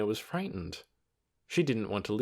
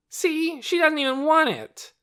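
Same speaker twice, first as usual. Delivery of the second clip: put-on bratty boy; 7 dB louder than usual. A narrator's reading. Child taunting, raised voice.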